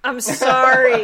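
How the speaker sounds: slow, hammed-up cry of sorry; mock-wailing apology to the resident German expert; loud mock apology. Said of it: "Steve's also gonna yell at you for that".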